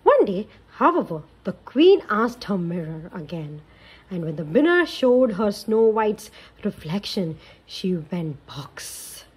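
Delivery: put-on lively read-aloud for kids; storybook narration. A narrator's reading. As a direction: tell it like a bedtime story. Narration continues.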